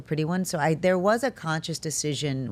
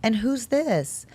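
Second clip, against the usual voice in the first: lightly mocked puzzled disdain, drawn out; 7 semitones higher, much slower than usual. Visitor singling out the plain daughter, mock puzzled. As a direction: imitate a visitor's slow, higher-pitched puzzlement.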